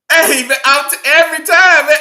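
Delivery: ordinary talk, nothing marked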